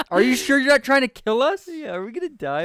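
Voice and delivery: loud joking panic; loudly mock the drink as poison; playful alarm. Real, not acted.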